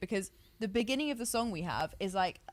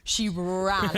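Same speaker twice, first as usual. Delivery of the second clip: usual speaking voice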